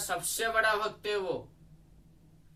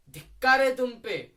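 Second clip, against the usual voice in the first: louder, higher mock condemnation; acted scornful reproach; 5 semitones higher, 6 dB louder than usual. People condemning a Ravana worshipper, imitated reproach. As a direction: throw it out as the crowd's rebuke. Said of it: "लोग क्या कहते?".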